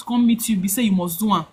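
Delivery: normal talking voice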